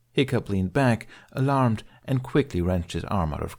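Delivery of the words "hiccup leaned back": ordinary delivery, nothing marked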